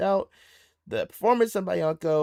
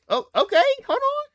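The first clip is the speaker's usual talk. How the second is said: surprised; 7 dB louder than usual. Real, not acted. Surprised by the group.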